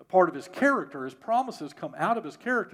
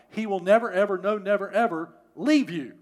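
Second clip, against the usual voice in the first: loud, raised punch on "never, ever, no, never, ever"; emphatic promise; 3 semitones higher, 5 dB louder than usual. Real, not acted. Preacher assures those trusting God of the promise, emphatic. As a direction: hammer every "never" and "ever".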